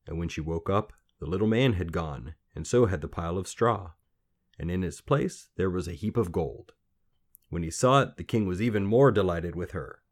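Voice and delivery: ordinary delivery, nothing marked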